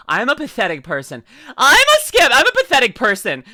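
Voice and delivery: hammed-up loud crying wail; mock wailing. Playing pathetic for the chat, a hammed-up loud crying wail. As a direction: wail it like a fake sob.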